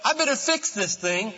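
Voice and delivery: loud, high preaching build; full-force preaching. Acted. Preacher proclaiming loudly to his church. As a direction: preach it out to the congregation.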